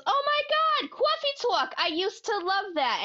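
high gushing fan voice; excited fan voice. Acted. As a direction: squeal it like a delighted fan. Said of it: "Tina Fey looks up and goes".

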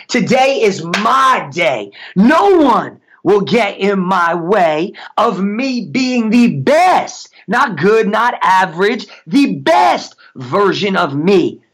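slow affirmation with swelling pitch and tone; enthusiastic, meant declaration. Shown as a demonstration. Coach showing listeners how to mean it, enthusiastic declaration. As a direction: own the moment, leaning on each key word. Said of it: "say with some enthusiasm".

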